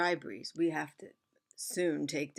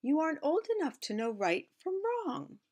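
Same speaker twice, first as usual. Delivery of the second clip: higher, firm motherly voice; the mother warning her little one; mother's voice; 10 semitones higher than usual. Acted. Mother scolding her pot, gently firm.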